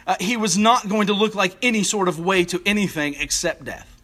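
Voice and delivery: ordinary talk, nothing marked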